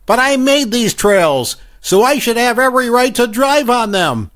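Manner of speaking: put-on high, snotty little kid; snobby kid voice. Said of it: "his snobby little voice said".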